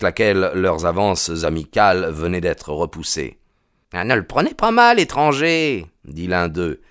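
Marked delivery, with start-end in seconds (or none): 3.9-5.9 policeman's voice pitched much higher, a bit faster; smooth over the stranger's anger; placating policeman. Acted. Policeman calming the angry stranger, high voiced.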